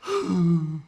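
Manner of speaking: sharp loud intake of breath; demonstrating a shame gasp; loud shallow gasp. Shown as a demonstration. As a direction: gasp like someone caught in shame. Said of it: "they're in the bottom of their lungs".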